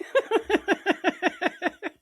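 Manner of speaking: high, sustained laughter; big laugh. Real, not acted. Parent laughing over children screaming at wake-up, long laugh.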